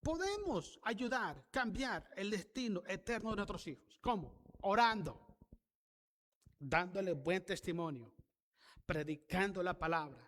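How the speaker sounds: loud, rising pulpit delivery; full-force preaching. Acted. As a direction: drive the message home to the congregation.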